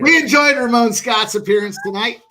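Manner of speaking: laughing mock sign-off. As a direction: announce it through laughter.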